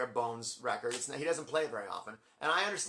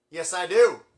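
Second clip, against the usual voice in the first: loud, raised eager reply; called-out answer; 4 semitones higher, 12 dB louder, slower than usual. Retold or imitated. Fan answers singer from the crowd, loud and sure. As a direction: call it back to the stage.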